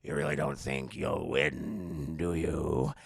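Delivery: low, slow Penguin voice; deep sneering Penguin; Penguin impression. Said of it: "And the Penguin's like".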